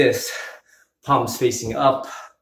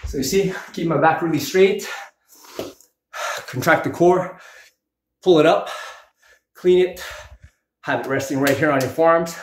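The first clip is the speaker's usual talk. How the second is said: talking through heavy breathing, pitched higher; breathless coaching between reps; 4 semitones higher than usual. Real, not acted. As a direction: explain while still winded. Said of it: "I'm already out of breath".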